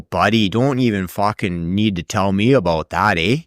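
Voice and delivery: hammed-up Canadian accent; broad Canadian with a tagged-on eh; typical Canadian accent. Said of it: "You know the typical Canadian accent".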